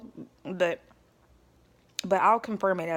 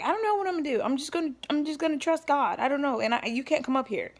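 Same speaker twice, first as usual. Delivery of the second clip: unsure retort; 8 semitones higher, faster than usual. Retold or imitated. Her answer to the ex.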